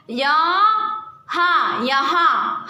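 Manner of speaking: यहाँ stretched very slowly, nasal आ held long; drawn-out demo word. Shown as a demonstration. Teacher to pupils, modelling the nasal vowel, slow drawn-out यहाँ. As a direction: hold the ending of यहाँ slowly. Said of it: "हा के बाद यहाँ".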